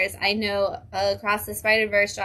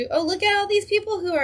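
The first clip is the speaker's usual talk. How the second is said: bright, fast surprised exclamation; delighted at the crowd; 11 semitones higher, faster than usual. Real, not acted. Surprised by viewers arriving.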